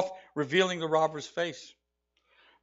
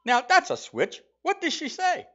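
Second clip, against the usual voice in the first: much higher, hammy Irish barman voice; bad Irish accent; 11 semitones higher than usual. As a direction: squeak it out as a nosy Irish barman. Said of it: "to learn a bad Irish accent".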